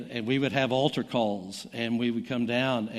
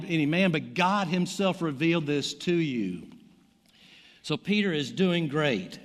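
raised, fervent preacher voice retelling scripture; energetic sermon delivery, pitched higher; preaching; 5 semitones higher than usual. Acted. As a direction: preach it out with rising conviction. Preaching the Gospel story fervently.

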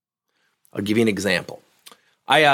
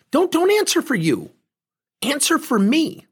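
pointed high challenge; 12 semitones higher than usual. Retold or imitated. Pressing the ex-employee.

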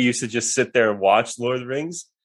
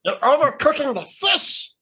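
high-pitched wretched creature; Golem; 12 semitones higher than usual. Acted. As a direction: pitch it far up like a fussing creature. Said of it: "'cause I was Golem".

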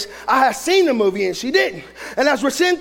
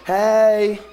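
slow, low, drawn-out cheery greetings; hammed-up carefree family chatter; mock happy family; 6 semitones lower, much slower than usual. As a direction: imitate a blissfully happy movie scene, lower and slower. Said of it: "Everything was happy. They was laughing".

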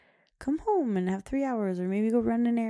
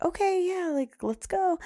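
hurried staff lady; 11 semitones higher than usual. Retold or imitated. Staff lady hurrying them.

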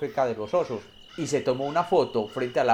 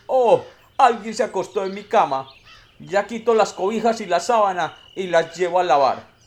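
higher, louder fussy mother bear voice; mama bear; 7 semitones higher, 7 dB louder than usual. Acted. Mama bear finds her bed messed up, higher fussy voice. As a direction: sing it out like a flustered mother bear. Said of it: "La mamá oso vio su cama también desarreglada y dijo".

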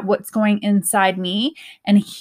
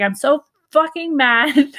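higher, drawn-out mock fury; retold anger; 5 semitones higher than usual. Replaying her angry journal entry, exasperated, drawn-out venting. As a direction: let the retold anger stretch out, pitched up. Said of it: "I was so mad".

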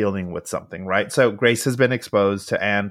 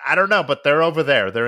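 put-on much higher, fast bratty kid voice; exasperated little girl; 7 semitones higher, faster than usual. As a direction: play a fed-up child blurting it out. Child Anne voiced, exasperated.

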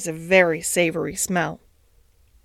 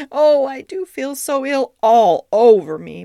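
loud, high plaintive moan; 5 semitones higher, 5 dB louder than usual. A narrator's reading. Duchess propped up and feeling ill, moaning. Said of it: "Duchess moaned and whined".

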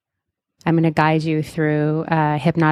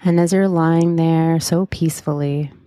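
guided meditation. Acted. Leads a hypnotic guided meditation.

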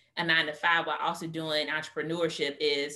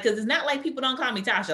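normal talking voice

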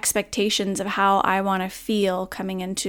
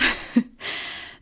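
a quiet, airy chuckle; soft breathy laugh; 3 dB quieter than usual. Real, not acted. Laughing softly at the noise interrupting the podcast. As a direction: let a little breath of laughter out.

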